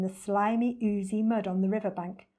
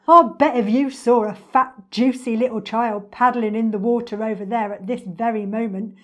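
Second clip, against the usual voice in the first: loud, relishing storybook crocodile; animated, louder boastful croc voice; greedy enormous crocodile, taunting; 2 semitones higher, 9 dB louder than usual. Acted.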